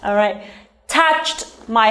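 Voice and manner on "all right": ordinary talk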